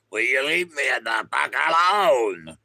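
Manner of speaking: annoyed snap. Acted. Acted quarrel over steak slicing.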